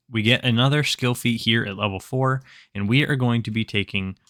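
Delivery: ordinary talk, nothing marked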